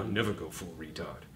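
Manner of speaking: movie quote. Retold or imitated. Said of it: "you all seen Tropic Thunder".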